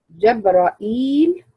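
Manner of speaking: "جبرائيل" slowly as jabra'iil, open a after jim and ra; fatha, hamza, ya. Shown as a demonstration. Teacher demonstrates the reading, "جبرائيل" as jabra'iil. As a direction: break "جبرائيل" with a hamza, then a long ii. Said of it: "فتح الجيم والراء، ثم همزة مكسورة وبعدها ياء".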